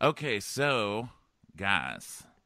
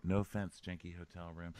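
much softer, lower mumbled apology; hushed aside; 4 semitones lower, 10 dB quieter than usual. Pretend. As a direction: drop it to a discreet aside.

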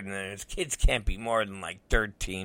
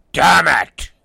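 loud, higher angry burst; frustrated outburst; 6 semitones higher, 11 dB louder than usual. Real, not acted. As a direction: vent the frustration loudly.

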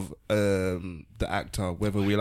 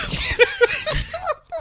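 loud cackle; 3 dB louder than usual. Real, not acted. Laughs at own joke.

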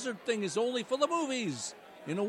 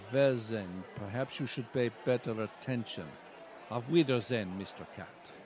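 put-on much lower, German-accented villain voice; Klaus Schwab impression; 12 semitones lower than usual. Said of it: "Klaus Schwab".

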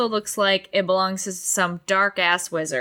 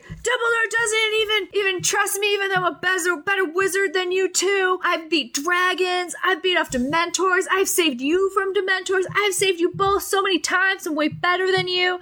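hammed-up bratty boast, high throughout; all-caps rant; 11 semitones higher than usual. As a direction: brag and whine pitched way up. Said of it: "this is in all caps".

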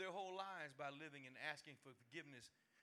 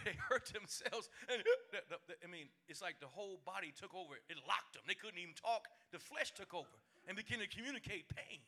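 ordinary delivery

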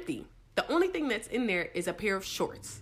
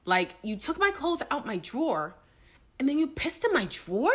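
normal talking voice

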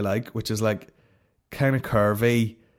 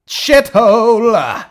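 high, loud drawn-out curse; exasperated shout; 11 semitones higher, 12 dB louder, much slower than usual. Real, not acted. Podcaster cursing where the internet won't load, exasperated shout. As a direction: yell it out long and high.

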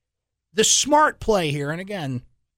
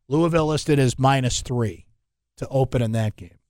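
ordinary talk

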